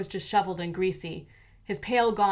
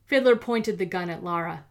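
plain reading voice

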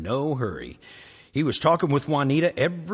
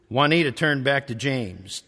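plain reading voice

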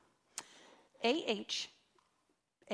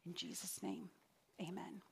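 prayer; 2 semitones lower, 5 dB quieter than usual. Acted. Closing prayer.

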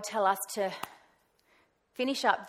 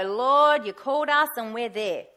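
louder, insistent call to the Lord; loud prayer; 2 semitones higher, 7 dB louder than usual. Retold or imitated. Telling God she answered His call, louder and insistent. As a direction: cry out to God with conviction.

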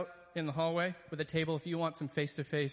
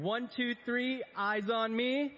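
higher sing-song classroom call; kindergarten teacher voice; 7 semitones higher, 5 dB louder, much slower than usual. Shown as a demonstration. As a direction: chant it up high like calling kids to order. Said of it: "I'm probably the only staff member that can get, get away with this".

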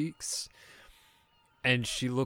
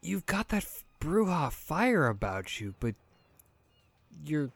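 higher, halting puzzlement; confused; 7 semitones higher than usual. Acted. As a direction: break off mid-thought, trying to work someone out. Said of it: "she's very confused".

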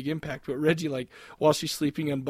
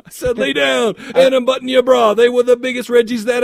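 loud, high-pitched rap lyric delivery; 8 semitones higher, 11 dB louder than usual. Acted. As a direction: belt out mock gangsta lyrics. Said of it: "good old [name], you know, those kind of lyrics".

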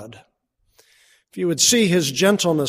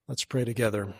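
usual speaking voice

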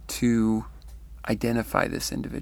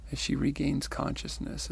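ordinary delivery

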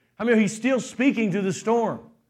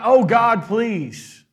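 loud, high mock plea to God; preacher mocking a begging prayer; 3 semitones higher, 6 dB louder, much slower than usual. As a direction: ham up a desperate beggar. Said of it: "he's not".

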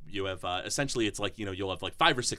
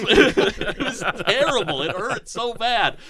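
laughing; 10 semitones higher, 9 dB louder, slower than usual. Real, not acted. Laughing over a sore throat.

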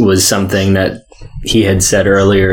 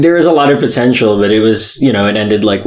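ordinary talk, nothing marked

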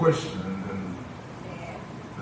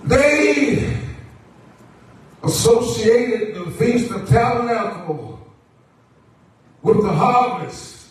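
projected sermon cadence in short, held phrases; preaching; 11 semitones higher, 7 dB louder, slower than usual. Acted. Preacher assures the church that the Lord always provides, preaching. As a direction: stretch key words, then pause for the congregation.

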